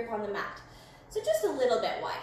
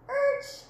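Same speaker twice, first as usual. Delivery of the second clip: car braking noise; 10 semitones higher, 4 dB louder than usual. Pretend. Car braking sound in game.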